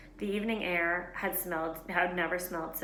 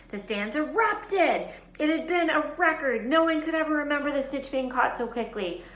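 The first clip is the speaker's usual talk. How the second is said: higher, louder, building triumph; excited narration; 8 semitones higher, 7 dB louder than usual. A narrator's reading. Narrator describes the record-breaking catch: excited. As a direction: tell it like the crowd's roar.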